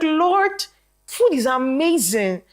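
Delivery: high, slow cry of wonder; amazed exclamation. Retold or imitated. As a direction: marvel aloud, high and drawn out. Exclaiming at friend's testimony.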